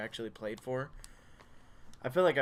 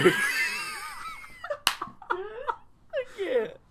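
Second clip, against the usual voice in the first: laughing fit; 4 dB louder than usual. Real, not acted. Breaks up mid-line.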